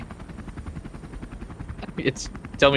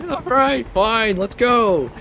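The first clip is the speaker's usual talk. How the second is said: high, slow grudging pilot voice; resigned pilot; 13 semitones higher, slower than usual. Acted. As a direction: drag out a reluctant yes.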